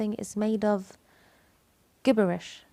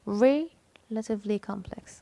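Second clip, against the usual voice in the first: slow "Relatively" broken after a stressed "re"; slower than usual. Shown as a demonstration. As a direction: isolate and lean on "Re", then finish. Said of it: "So the stressed syllable is". ("Re" مشددة ومفصولة في "Relatively")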